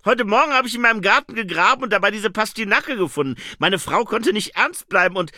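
loud, high, fast chatterer; Herr Windler. Acted. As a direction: rattle on loudly about your funny find. (laut, hoch und aufgekratzt plappernd)

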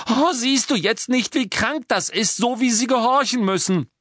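high-pitched, loud, upset outburst; distressed cry. A narrator's reading. Girl appalled at the elves' forced obedience, shrieking. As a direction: plead at full pitch, appalled. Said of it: "schrie Hermine".

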